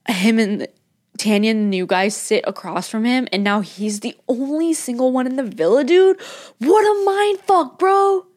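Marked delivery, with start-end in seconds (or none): 5.8-8.3 dismayed cry; excited outburst. Real, not acted. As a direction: burst out in high-pitched disbelief. Outburst over Shaq.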